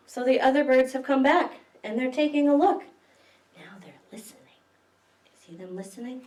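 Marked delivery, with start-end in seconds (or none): 3.6-6.2 soft hushed whisper. Real, not acted. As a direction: whisper so the birds can listen. Whispers listening.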